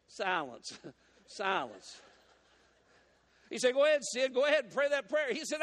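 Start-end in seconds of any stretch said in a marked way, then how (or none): 3.7-5.3 higher and louder, pushing him on; eager urging. Acted. Pastor urging Sid to pray that prayer, eager and loud. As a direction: press him to go on.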